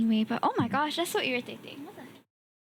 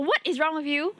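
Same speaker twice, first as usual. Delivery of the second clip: ordinary talk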